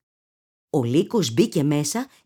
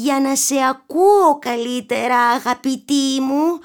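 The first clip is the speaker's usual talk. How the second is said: wolf hiding excitement; 7 semitones higher, 3 dB louder than usual. A narrator's reading. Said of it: "προσπαθώντας να κρύψει τον ενθουσιασμό του".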